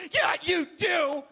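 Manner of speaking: high, drawn-out accusing retort; indignant confirmation. Acted. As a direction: stretch each word out accusingly.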